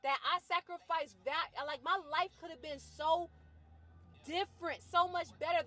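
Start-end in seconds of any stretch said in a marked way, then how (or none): none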